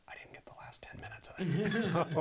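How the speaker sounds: whispered confession of a screw-up; hushed, voiceless admission to a colleague; sheepish whisper. Retold or imitated. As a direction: whisper it like bad news you dread sharing.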